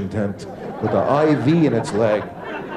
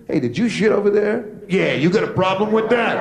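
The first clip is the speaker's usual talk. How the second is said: tough dog; 8 semitones higher than usual. Acted. Owner and tough dog exchange.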